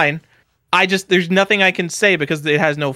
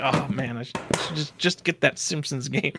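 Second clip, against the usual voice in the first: quiet inner thought; 10 dB quieter than usual. Retold or imitated. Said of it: "then I'm like".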